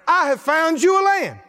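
booming proclamation of a divine promise; proclaimed shout. Acted. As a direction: call it out like God speaking. God's promise proclaimed in sermon.

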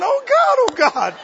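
very high, loud alarmed cry; panicked cry. Retold or imitated. Helper watching the preacher drag the man, panicked cry. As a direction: wail it in disbelief. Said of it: "I was going".

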